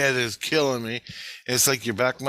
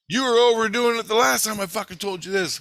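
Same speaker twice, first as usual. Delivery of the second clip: scolding; 11 semitones higher, 4 dB louder than usual. Retold or imitated. Acts out Christ scolding her.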